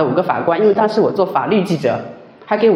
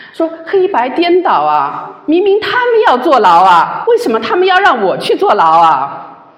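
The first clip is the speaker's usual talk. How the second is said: higher, louder, pained protest; anguished outcry; 7 semitones higher, 4 dB louder than usual. Retold or imitated. Crying out to God over her unjust lawsuit, anguished outcry. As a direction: cry out at the injustice. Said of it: "就是哭诉啊".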